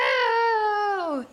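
high, loud cry of shock. Real, not acted. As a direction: gasp it out in disbelief. Player reacts to friend's diary.

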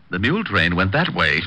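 ordinary talk

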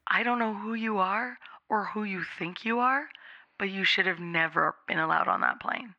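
hushed stern airport agent; stern official. Retold or imitated. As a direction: lean in and lay down the law quietly.

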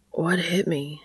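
husky, near-whispered mumble; mumbled. A narrator's reading. Sam coming round after a blow, dazed mumble. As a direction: mumble it under your breath, barely voiced. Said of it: "he mumbled".

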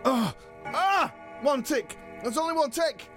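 high-pitched flustered panic; anxious fretting. Acted. Wrestler watching his text for read receipts, anxious. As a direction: fret at the screen, voice up high.

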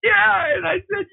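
high, laughing burst as she begins the line; can't hold back the laugh. Real, not acted. Laughing as she tells the interviewer about the call.

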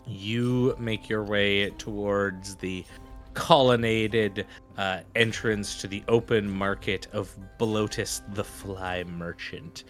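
usual speaking voice

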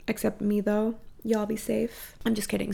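ordinary delivery

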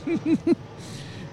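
squealing laugh; high-pitched giggle burst. Real, not acted. Laughing at name struggle.

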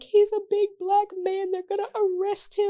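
high, frantic retold worry; panicked fear. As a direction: imitate her panicking for Kevin. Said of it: "And I was like".

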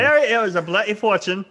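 teasing a co-host in a mock British accent; bad British accent. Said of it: "that's a horrible accent".

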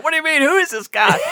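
high-pitched, incredulous challenge; mock indignation. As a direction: imitate guests turning on the intruder. Everyone turning on him.

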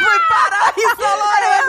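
cracking up at the punchline; laughing. Real, not acted. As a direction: burst out laughing through it.

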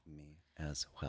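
breathy barely audible whisper; whispered agreement. Acted. Co-host whispering agreement about cyclists. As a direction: hush it to nothing.

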